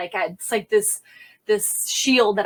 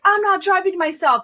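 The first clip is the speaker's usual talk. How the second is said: high, raised-voice refusal; acted-out yelling back; 10 semitones higher, 4 dB louder than usual. Officer refusing her sergeant's order, high raised voice. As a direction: refuse hotly, pitched up. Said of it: "yelling back at him".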